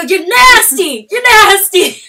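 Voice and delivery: high, drawn-out, very loud shout; angry yelling. Retold or imitated. Woman storming after the flasher, angry yelling. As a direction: shout it after him in disgust. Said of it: "I'm yelling at him".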